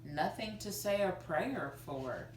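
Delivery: ordinary delivery, nothing marked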